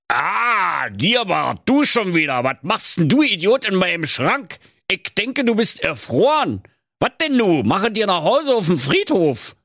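put-on high, scornful old miser scolding his partner; old miser berating the ghost; indignant miser. A narrator's reading. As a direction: tell the ghost off.

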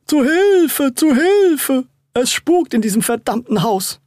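high, loud mock-panicked cry for help; hammed-up scream with drawn-up pitch; theatrical cry. A narrator's reading. Boy jumps into his brother's arms, theatrical cry. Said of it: "mit einer theaterreifen Einlage in die Arme und schrie".

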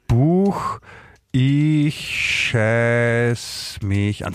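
slowly dictating while typing, words stretched long; typing aloud, drawn out. Shown as a demonstration. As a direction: spell it out slowly as you type. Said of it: "ich geb mal ein bei Google".

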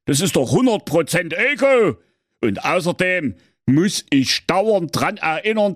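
high, blustering, self-important king; pompous king voice. A narrator's reading. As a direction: lay down the law like a spoiled king. King insisting on his authority.

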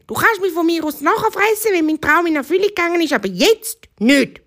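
high, urgent little hare voice; pleading bunny. Acted. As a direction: bargain for your life like a small hare.

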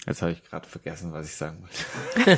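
sheepish fading mumble with a laugh; trailing off. Real, not acted. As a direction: let the words die away, embarrassed. Forgot what he wanted to say.

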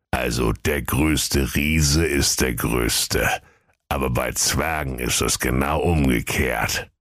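slow, low ancient tortoise; oracle tortoise explaining. Acted. Tortoise rules on who is greatest, old and deliberate. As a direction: spell out the riddle slowly and low.

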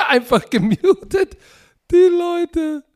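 hoch und kichernd gesprochen; lachend. Real, not acted. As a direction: durch das Lachen hindurch reden. Lacht über Mikroausfall.

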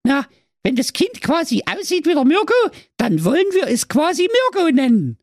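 high squeaky Saxon wife, pleased; delighted wife. Acted. Wife Waltraud happily naming the baby, high squeaky voice. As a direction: chirp out the baby's name happily. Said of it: "rief erfreut".

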